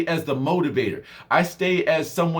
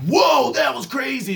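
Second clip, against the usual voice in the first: loud, high mock amazement; excited shout; 9 semitones higher, 3 dB louder, slower than usual. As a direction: shout it like you can't believe it. Mock excited reaction quote.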